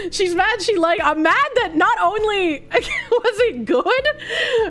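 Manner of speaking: slow words broken by laughs; real laughter cutting the words; laughing while talking. Real, not acted. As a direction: giggle through the line. Laughing at own joke.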